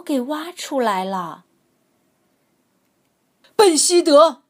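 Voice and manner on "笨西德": loud roaring angry shout; growled warning. A narrator's reading. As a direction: bellow it in rage. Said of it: "皮特咆哮道".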